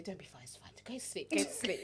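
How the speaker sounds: whispered brush-off; sleepy dismissal. Retold or imitated. Imitating mum at night sending her to sleep, whispered. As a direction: hush her back to bed.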